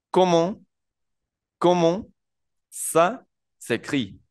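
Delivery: high, slow French "Comment ça s'écrit?"; slow French demo. Shown as a demonstration. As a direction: model "Comment ça s'écrit?" slowly for students. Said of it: "la tercera es". ("Comment ça s'écrit?" despacio)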